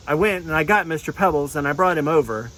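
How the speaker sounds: ordinary talk, nothing marked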